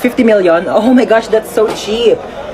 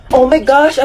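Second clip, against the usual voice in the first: usual speaking voice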